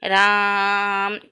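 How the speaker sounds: राम with the aa stretched very long on a level note; prolonged Ram. Shown as a demonstration. Teacher demonstrating the triple-length vowel with Ram, hugely drawn out. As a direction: hold राम long and steady before closing on m. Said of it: "दुगुना तिगुना हमको समय लग रहा".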